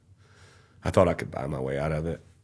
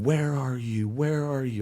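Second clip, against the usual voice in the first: retold scream at God; 7 semitones higher, slower than usual. Retelling his jail cell breakdown, a pitched-up imitated scream. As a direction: cry out to an absent God, pitched up.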